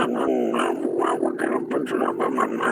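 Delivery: very low slow warped voice; slowed down monster voice; deep voice changer. Pretend. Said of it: "It's so hard to tell what you're saying".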